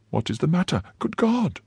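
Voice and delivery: startled, worried cry; high anxious question; alarmed. A narrator's reading. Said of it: "asked the poor woman".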